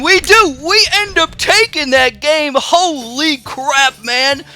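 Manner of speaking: loud, high victory shout; excited shouting. Real, not acted. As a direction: yell it out, you just won. Winning a tense game.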